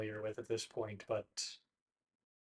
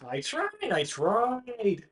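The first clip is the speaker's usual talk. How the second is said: high, whiny protest; defensive friend; 9 semitones higher, much slower than usual. Acted. Paxton protests to Bartleby that he tried, high. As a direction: plead your case, pitched up. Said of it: "He goes".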